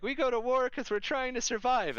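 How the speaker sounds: very high, put-on Eldar voice; exaggerated high-pitched alien plea; mock Eldar. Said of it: "the Eldar are like".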